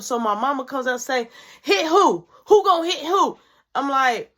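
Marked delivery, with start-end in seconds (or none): 1.6-3.4 higher, louder demand; mother demanding who will hit who; angry mom. Retold or imitated. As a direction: snap the question like a mad mom. Mother bursting in.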